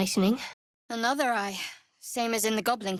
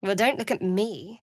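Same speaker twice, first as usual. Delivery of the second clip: ordinary delivery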